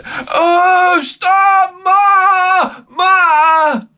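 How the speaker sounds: put-on high, loud childish wail for Ma; whiny sick kid. Sick child calling for his mother, whiny wail.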